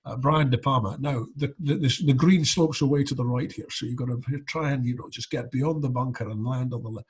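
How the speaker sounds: lightly voiced Scottish Connery musing; a hint of Connery's Scottish lilt; Connery on golf. Connery dodging a film question for golf, light Scottish. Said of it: "And he'll say".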